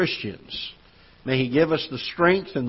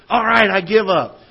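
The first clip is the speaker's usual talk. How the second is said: higher, louder reluctant give-in; grudging surrender; 6 semitones higher, 6 dB louder than usual. Retold or imitated. Boy finally yielding to his cousin, grudging. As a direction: give up under protest. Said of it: "I'd finally say".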